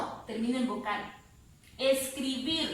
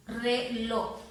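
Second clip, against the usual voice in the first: Reloj split in two, force on the last syllable; stress on final syllable. Shown as a demonstration. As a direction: punch the LOJ in Reloj. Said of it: "ahí está la fuerza de voz".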